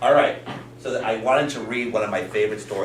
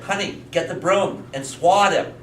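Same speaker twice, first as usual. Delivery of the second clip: higher, slow storybook command; father in story; 8 semitones higher, 3 dB louder than usual. Acted. Father tells wife to chase bear, slow pitched-up command. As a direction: give a mock-urgent order, drawn out.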